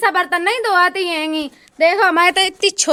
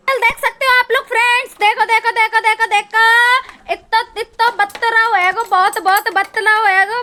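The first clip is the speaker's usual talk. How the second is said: higher, louder eager calls; excited; 6 semitones higher, 5 dB louder than usual. Real, not acted. Girl excitedly showing her friends the view, higher and louder. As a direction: urge them to look.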